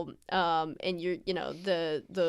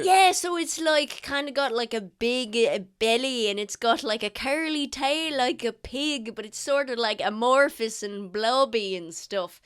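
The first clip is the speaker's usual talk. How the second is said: put-on eager old sailor; 6 dB louder than usual. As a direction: gush about sea creatures like a proud old sailor. GM voices the sailor husband.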